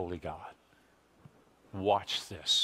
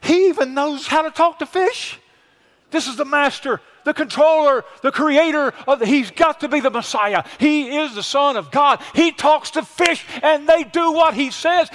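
rising, much higher and louder until a shout on Lord; Peter's awe; 11 semitones higher, 6 dB louder than usual. Acted. Preacher voicing Peter's thoughts about the fish, building excited shout. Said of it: "You know what Peter's thinking?".

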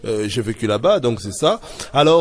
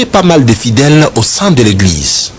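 much louder documentary reporter voice; news report voiceover; 13 dB louder than usual. Acted. Reporter introduces the second sermon, in a loud voiceover.

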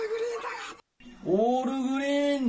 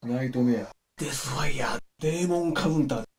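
low villain voice; 8 semitones lower, faster than usual. Acted. Low dramatic attack names.

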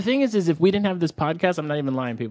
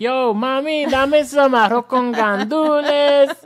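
higher, slow hammed-up Puerto Rican order; Puerto Rican Brooklyn accent; 6 semitones higher, 5 dB louder, slower than usual. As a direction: playfully show off your Nuyorican side, slowly. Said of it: "I'd be like".

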